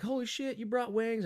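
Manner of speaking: high, surprised mock exclamation; high excited holy shit; excited quote. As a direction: squeal it like friends seeing food. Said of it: "they're like".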